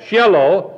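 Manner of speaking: Shiloh as SHEH-loh, no long I; Shiloh with a short first vowel, not SHY-loh; Hebrew pronunciation. Shown as a demonstration. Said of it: "It's simply pronounced".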